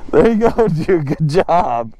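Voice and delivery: ordinary delivery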